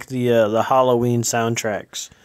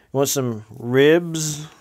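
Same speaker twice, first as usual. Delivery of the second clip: slow code phrase; much slower than usual. Pretend. Code phrase for illegal music.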